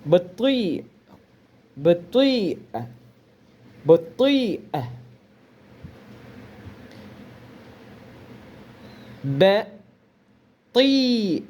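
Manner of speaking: bati with a short a and a clipped final i; Arabic word bati. Shown as a demonstration. Teacher drills Arabic bati for slow learners. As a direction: repeat bati as a careful drill. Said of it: "É assim mesmo a pronúncia".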